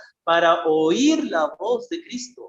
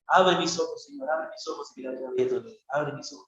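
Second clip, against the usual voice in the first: soft, quick prayerful reading; hushed meditation on a psalm verse; meditative murmur; 9 semitones lower, 8 dB quieter than usual. Shown as a demonstration. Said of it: "así como se medita la palabra".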